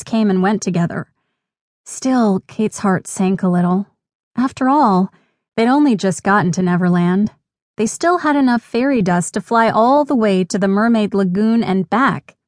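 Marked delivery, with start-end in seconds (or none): none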